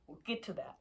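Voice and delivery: whispered aside; whispering. Real, not acted. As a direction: say it under your breath.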